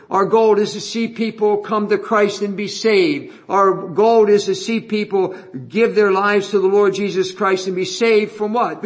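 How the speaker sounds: ordinary talk, nothing marked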